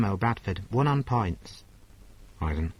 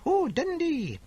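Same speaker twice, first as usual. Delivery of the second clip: shrieking, much higher "Oh, Dundee!"; excited squeal; 3 dB louder, slower than usual. Acted. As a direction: squeal it with delight. Excited squeal at city name.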